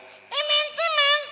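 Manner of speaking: small voice. Shown as a demonstration. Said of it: "Harus dari dalam".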